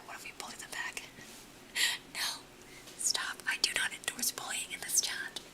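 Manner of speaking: ordinary talk, nothing marked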